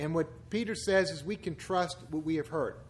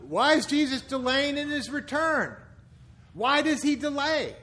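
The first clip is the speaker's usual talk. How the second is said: skeptic's question; 6 semitones higher, 4 dB louder than usual. Retold or imitated.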